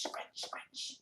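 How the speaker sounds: whispered scratchy "scratch" noise; scratching sounds. Pretend. Acting out scratching for a toddler, quick raspy whisper. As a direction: make the word sound like claws scraping. Acting out the book's action word.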